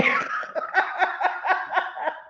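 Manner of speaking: high breathless laugh; cracking up. Real, not acted. Laughing at own joke.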